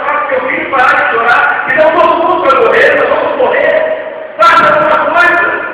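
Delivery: loud, high, desperate demand; panicked shout. Retold or imitated. Crowd in the boat yells at the mother, panicked shouting. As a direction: shout at her to save everyone. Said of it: "todo mundo em cima dela".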